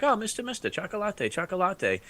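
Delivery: high, soft imitation of pestering children; begging kids. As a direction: mimic foreign kids pleading for sweets. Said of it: "And kids coming up".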